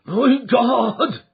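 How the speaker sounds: put-on elderly servant crying in horror; horrified cry. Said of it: "he cried".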